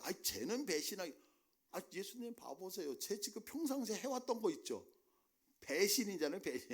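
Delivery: high, excited mock tattling on a friend; Peter tattling. Preacher playing Peter pointing at a deacon, eager mock tattling. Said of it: "막 이러면서 누군가를 찝었을 거야".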